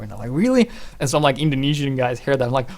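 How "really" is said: high, loud astonished question; surprised. Retold or imitated. Casters hearing his sniper claim, high and astonished. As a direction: sound amazed. They react in disbelief.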